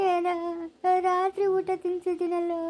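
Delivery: high, tearful calls to the dead; grieving, broken by long sobs; sobbing lament. Acted. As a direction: wail his name between sobs. Weeping over dead son.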